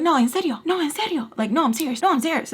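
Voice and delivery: high-pitched insistent quote. Retold or imitated. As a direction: imitate someone pressing the point. Acts insistent questioner.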